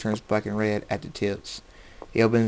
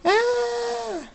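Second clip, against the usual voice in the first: far-off, high long scream; distant scream. A narrator's reading. A victim screaming down the hallway, high distant scream. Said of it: "A voice screams".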